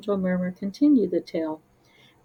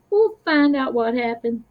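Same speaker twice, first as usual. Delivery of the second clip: reassuring; 4 semitones higher, 4 dB louder than usual. Acted. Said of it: "Slothling reassured him".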